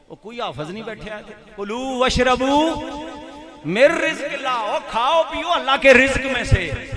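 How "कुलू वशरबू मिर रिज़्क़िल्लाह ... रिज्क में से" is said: loud, raised sermon voice; ringing pulpit call and answer; rousing preaching. Real, not acted. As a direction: fire the repeated question at the congregation, then answer it forcefully. Preaches, repeating rhetorical question.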